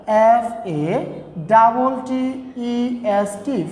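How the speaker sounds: slow, letter-by-letter spelling. Shown as a demonstration. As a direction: spell it out slowly for the class. Spells the word for students. (ধীরে ধীরে বানান করে)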